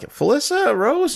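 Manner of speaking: Spookala stayed out, this one flies high and slow; "Felissa Rose?" rising way up, drawn out in delighted disbelief; starstruck surprise. Retold or imitated. Fan meeting a movie star, high starstruck surprise.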